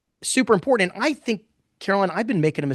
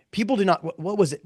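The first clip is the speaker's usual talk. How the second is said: ordinary talk, nothing marked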